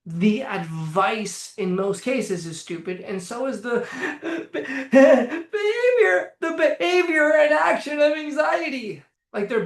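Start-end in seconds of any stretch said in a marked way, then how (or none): 3.6-9.0 laughs through it, voice jumping high and loud; laughing. Real, not acted. Mocking anxious behavior as stupid, laughing. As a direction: breaks into laughter mid-sentence.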